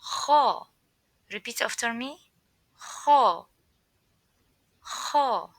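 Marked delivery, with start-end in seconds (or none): 0.0-0.7 loud rasping Kha; snoring-like Kha. Shown as a demonstration. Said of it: "the sound of snoring". 2.8-5.5 loud rasping Kha; snoring-like Kha. Shown as a demonstration. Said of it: "the sound of snoring".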